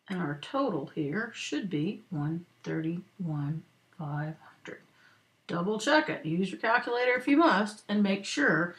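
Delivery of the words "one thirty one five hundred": slow and soft, fading to a near whisper; writing aloud. Real, not acted. Teacher writing in the total for students, slow fading murmur. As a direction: let the figures trail slowly as you write.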